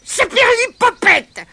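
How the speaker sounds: very high, loud squeaky cry; leprechaun's cry. Acted. Leprechaun caught by Pat cries out, very high and loud. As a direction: shriek like a tiny goblin. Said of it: "s'écria le lutin".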